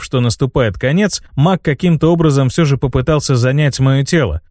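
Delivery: usual speaking voice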